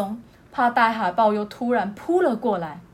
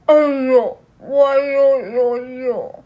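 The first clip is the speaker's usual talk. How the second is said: loud ouch cries fading away; pained groans; 3 semitones higher, 8 dB louder, much slower than usual. Acted. Seal crashing into the rock, loud groans fading. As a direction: cry out after hitting the rock, then trail off. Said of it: "一头撞上去".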